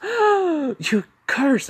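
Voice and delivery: very high, loud gasped exclamation; shocked squeal. Acted. Katsumi gasps at him swearing.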